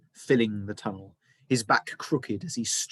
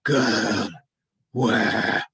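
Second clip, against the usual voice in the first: grinding stone voice; 6 dB louder than usual. A narrator's reading. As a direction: scrape the words out like rock. Menacing sailor repeats demand.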